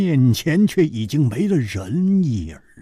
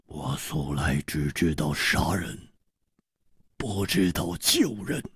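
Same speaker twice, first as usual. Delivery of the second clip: low, soft, icy statement; coldly; 9 semitones lower, 8 dB quieter than usual. Acted. Killer answering Song Gang's reproach, coldly. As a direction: state it coldly, pitched well down. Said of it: "冷冷地说".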